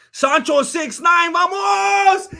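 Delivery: loud, high cheering shout; big stream cheer; excited shout. Real, not acted. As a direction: hype the donor up at full voice.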